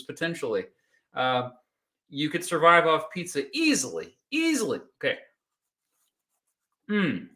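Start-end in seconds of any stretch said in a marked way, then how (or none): 4.3-4.8 "easily." jumps much higher, falling; emphatic high repeat. Real, not acted. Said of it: "repeats easily". 5.0-7.3 chewing while talking; mouth full. Real, not acted. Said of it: "talking with my mouth full".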